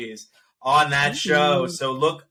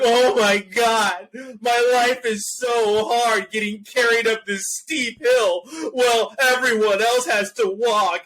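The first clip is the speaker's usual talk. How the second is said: mock whining; 7 semitones higher than usual. Uncle mocking the carried niece, hammed-up whine. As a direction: whine like a put-upon martyr. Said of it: "'cause I'm like".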